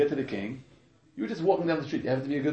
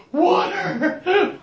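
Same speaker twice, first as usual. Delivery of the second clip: much higher mock yell for water, half laughing; demanding king; 7 dB louder than usual. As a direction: play the king calling out for water at night. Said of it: "He's like".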